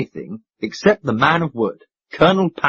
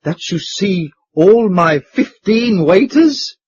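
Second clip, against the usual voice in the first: slow, higher, quaking disbelief; shaken surprise; 6 semitones higher, 4 dB louder, slower than usual. Acted. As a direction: ask in trembling shock.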